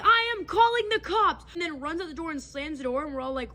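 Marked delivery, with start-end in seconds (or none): 0.0-1.4 mock yelled threat. Roommate ranting at friends.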